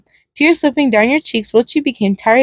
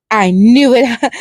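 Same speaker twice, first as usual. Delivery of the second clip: sinister clown; 3 dB louder, much slower than usual. Acted.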